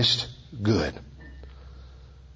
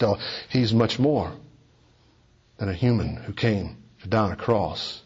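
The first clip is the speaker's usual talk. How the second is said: ordinary talk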